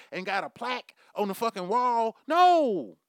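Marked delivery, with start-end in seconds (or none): 2.3-3.0 sudden high, loud flat denial; a sharp, loud no; loud emphatic no. Real, not acted. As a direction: shut it down with a loud no. Emphatic rebuttal to own rant.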